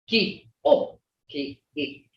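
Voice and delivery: ordinary talk, nothing marked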